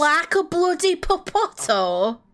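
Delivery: northern accent imitation. Streamer echoes the shopkeeper in mock northern English accent. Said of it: "Yorkshire Mancunian".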